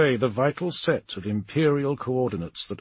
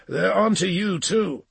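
higher, louder urgent warning; 9 semitones higher, 5 dB louder than usual. A narrator's reading. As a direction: warn them in a raised character voice. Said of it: "John warned".

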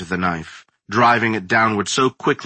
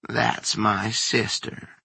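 slow creepy crone; 5 dB quieter, slower than usual. A narrator's reading. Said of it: "the old woman behind the door said".

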